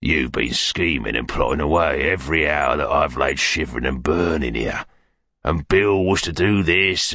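rough villain building a list of grievances; Sikes, bitterly resentful. Acted.

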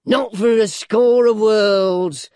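high, loud, very drawn-out; slow, gloating mock sincerity; grinning dwarf. A narrator's reading. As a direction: say it through a sly grin. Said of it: "replied the dwarf with a grin".

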